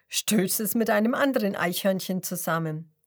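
usual speaking voice

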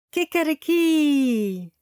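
high, loud and slow cock-a-doodle-doo; rooster crow. A narrator's reading. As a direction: crow loudly to wake the farm. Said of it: "Er ruft laut".

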